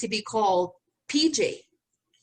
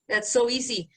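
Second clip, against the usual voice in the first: ordinary delivery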